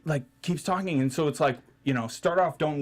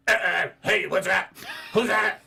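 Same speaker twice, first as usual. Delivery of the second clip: high, loud froggy pestering; 7 semitones higher, 4 dB louder than usual. Retold or imitated. Girl behind him constantly asking questions, froggy voice impression. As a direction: croak it out as an impression. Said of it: "She had a little bit of a froggy voice".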